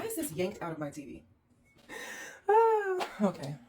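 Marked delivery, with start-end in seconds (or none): 2.5-3.1 sudden high anguished yelp; a sharp cry of dismay at the mess; pained cry. Real, not acted. Host yelping in dismay at a trashed room, high pained cry.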